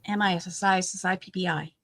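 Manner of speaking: fast, rhythmic letter-by-letter spelling; quick run of spelled letters; rote spelling. Real, not acted.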